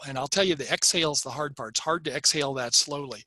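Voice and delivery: ordinary talk, nothing marked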